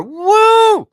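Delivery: loud, high "woo" cheer; excited whoop. Real, not acted. Cheering the winner of the game, high excited whoop. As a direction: let out a piercing celebratory whoop.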